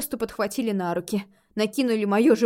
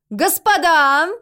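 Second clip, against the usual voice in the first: high, loud call to the room; solemn announcement; 7 semitones higher, 10 dB louder, much slower than usual. A narrator's reading. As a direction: proclaim it grandly to a crowd. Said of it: "торжественно возвестила Рози".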